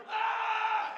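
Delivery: very high, held shriek; mock scream of fear and pain. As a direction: imitate a frightened, hurt little boy screaming. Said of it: "screaming out of fear and pain".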